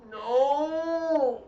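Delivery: long, high wailed no; drawn-out dismay. Real, not acted. Streamer reacting to subtitles, drawn-out high dismay. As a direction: stretch NO out high in protest.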